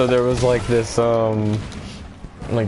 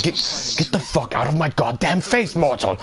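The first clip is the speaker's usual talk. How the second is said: higher, sneering god yelling at a mortal; mock angry Zamasu; 7 semitones higher than usual. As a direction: spit it out like a disgusted god. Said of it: "Zamasu's just like".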